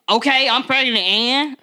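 defiant. Acted. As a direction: throw it back as a pregnant wife's challenge. Acted defiant retort to spouse.

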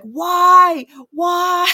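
high, loud exasperated why, why; retold outburst of frustration at one thing after another; frustrated cry of why. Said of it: "I was like".

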